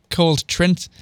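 usual speaking voice

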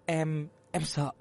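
soft, slow, trembling admission; frightened. Acted. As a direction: shrink back, scared. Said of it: "co rúm người lại". (nói sợ hãi khe khẽ)

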